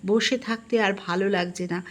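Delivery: plain reading voice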